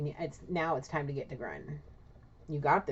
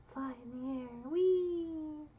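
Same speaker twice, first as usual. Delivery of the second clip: put-on high squealing whee of a tossed child; playful whee; 11 semitones higher, much slower than usual. Father tossing baby up, child squealing high with delight. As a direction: squeal with delight as you're thrown up.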